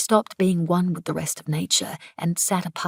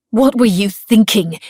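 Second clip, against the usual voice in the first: loud, higher, furious question; angry demand; 4 semitones higher, 10 dB louder than usual. A narrator's reading. Woman scolding coworker, loud and furious. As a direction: demand an answer, outraged.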